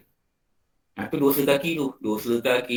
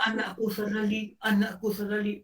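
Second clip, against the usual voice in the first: higher, softer grieving father; father grieving over his son, higher and softer; softer father's lament; 7 semitones higher, 6 dB quieter than usual. Acted. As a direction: murmur the son's name, heartbroken and hushed. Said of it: "wah, kau berlakon".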